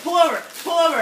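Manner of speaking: loud, high mock-panicked shout; urgent yell. Faking nausea to the driver on the interstate, urgent yell. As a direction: yell it as if about to be sick.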